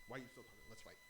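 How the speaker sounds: soft, breathless dare; hushed eager taunt. Retold or imitated. Taunting the man in his car, breathy and hushed. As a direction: whisper the invitation to fight. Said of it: "Smiling, laughing".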